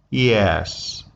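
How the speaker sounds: a long, low falling "Yes". Shown as a demonstration. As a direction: let "Yes" drop low. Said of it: "Third type is called falling tone".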